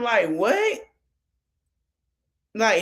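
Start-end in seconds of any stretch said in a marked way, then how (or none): 0.3-0.8 high-pitched, incredulous "what?"; indignant attitude. Retold or imitated. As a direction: imitate her taken-aback look in the voice. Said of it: "looking at him with attitude".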